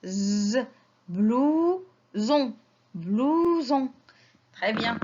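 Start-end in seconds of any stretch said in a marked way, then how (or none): 0.0-0.6 "Z" as a long buzzing consonant; isolated Z sound. Shown as a demonstration. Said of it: "je la prononce". 1.1-3.9 "blouson" in two stretched syllables, with a buzzing z; voiced Z pronunciation. Shown as a demonstration. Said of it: "je la prononce".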